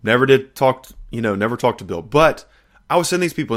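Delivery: ordinary talk, nothing marked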